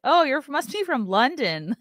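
naive exclamation. Retold or imitated. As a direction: gush it, sending up your own cluelessness. Mocks her own naive reaction.

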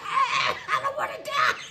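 high, squealing cry of fright; panicked kid. Retold or imitated. Kid dodging dad's backhand in the car, high panicked squeal. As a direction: shriek it like a scared child dodging.

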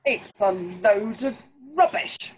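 loud, dismissive royal shout; shouting king. Acted. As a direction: bark it out like an arrogant king. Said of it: "he shouted".

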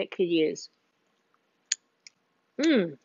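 talking with a mouthful; chewing while speaking; mouth full. Real, not acted. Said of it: "as I talk with my mouth full".

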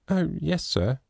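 put-on a gentle child answering sadly; sorrowful. A narrator's reading. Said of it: "she said sorrowfully".

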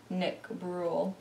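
ordinary delivery

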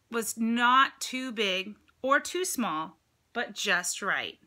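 drawn-out slow storybook reading; slow, emphatic read-aloud; storybook emphasis. A narrator's reading. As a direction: stretch it out like a fairy tale. Reads picture book aloud.

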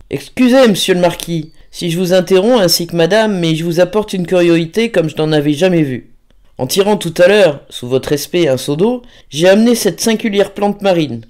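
eager, apologetic rustic reporting a curious find; deferential old-fashioned gardener. Acted. Gardener interrupting the marquis with his find, deferential and eager.

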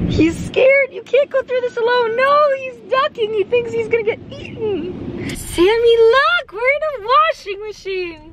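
high-pitched pet talk in the car wash. Real, not acted. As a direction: coo at the trembling puppy. Talking to scared puppy.

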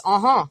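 uh-huh, the vowel ah through the nose; nasal uh-huh. Shown as a demonstration. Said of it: "that is".

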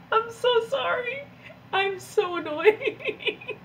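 high, slow, through helpless laughter; laughing apology. Real, not acted. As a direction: apologise while you can't stop laughing. Cracking up at herself.

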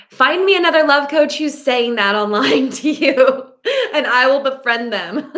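laughing. Real, not acted. Laughs at own joke.

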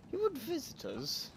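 put-on high child voice. Voicing child character.

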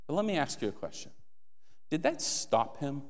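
ordinary talk, nothing marked

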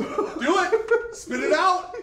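loud laughing shout. Real, not acted. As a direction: yell it out, cheering. Friends chanting at taster.

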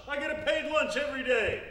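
ordinary talk

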